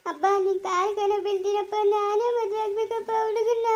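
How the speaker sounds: desperate crying plea; tearful begging. Acted. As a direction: beg him through tears. Daughter begs at father's feet.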